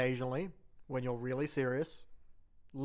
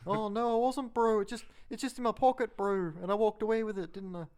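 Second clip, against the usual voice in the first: lightly hammed New Zealand bloke caught stealing; mock shoplifter; 6 semitones higher than usual. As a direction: play the innocent thief, high and brash. Acted New Zealand shoplifter exchange.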